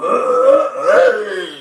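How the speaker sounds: a loud, rough, choking ugh; mock choking groan; coughing groan. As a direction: hack it out like you just coughed up smoke. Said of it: "this dude went".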